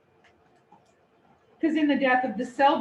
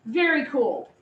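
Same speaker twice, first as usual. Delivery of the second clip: ordinary talk, nothing marked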